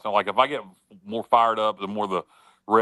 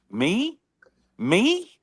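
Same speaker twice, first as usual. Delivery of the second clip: incredulous; 12 semitones higher than usual. Real, not acted. Mock indignation at being called out.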